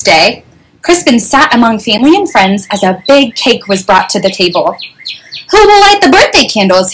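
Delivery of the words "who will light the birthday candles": high-pitched lightly voiced mother; mother's voice lifted for a question; mother asking. A narrator's reading. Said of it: "his mother asked".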